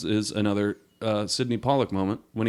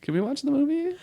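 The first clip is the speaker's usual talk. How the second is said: much higher, pleading; impatient viewer begging to get on with it; mock whiny plea; 3 dB quieter than usual. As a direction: whine it like an annoyed viewer.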